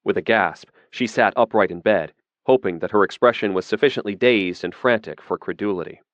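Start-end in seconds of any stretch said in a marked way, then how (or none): none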